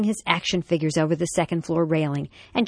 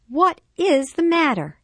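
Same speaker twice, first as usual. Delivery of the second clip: high, louder, emphatic question; exasperated mom; 12 semitones higher, 5 dB louder than usual. A narrator's reading. Mom sitting her son down, exasperated demand. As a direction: press an upset child for an answer.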